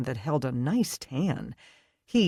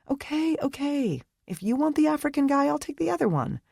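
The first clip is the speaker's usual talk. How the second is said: higher, quick, playful bargaining; giddy girlfriend voice; 12 semitones higher, faster than usual. A narrator's reading. Brittany bargaining with Leah, giddy and high. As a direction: toss it off like a cheeky friend.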